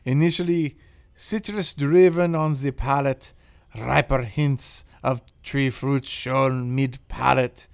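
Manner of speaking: loud, slow, hammed-up French accent; bad French accent. Host reads the wine review in a deliberately bad French accent. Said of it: "I will provide a bad French accent".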